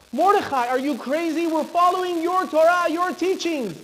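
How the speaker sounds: loud, high exasperated plea; acted outburst of disbelief; indignant protest. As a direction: protest as if he's lost his mind.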